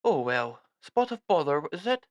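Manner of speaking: cheerful British army understatement; British officer. Acted. British officer NPC speaking.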